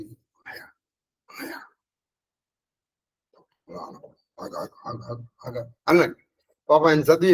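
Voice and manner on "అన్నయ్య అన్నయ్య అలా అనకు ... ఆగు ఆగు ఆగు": much softer, half-whispered pleading to stop; soft hushed plea. Retold or imitated. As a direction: hush your brother gently, almost unvoiced. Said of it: "అల్లన నెమ్మదిగా అన్నాడు". (మెల్లగా గుసగుసగా వారించడం)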